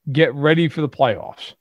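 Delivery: ordinary delivery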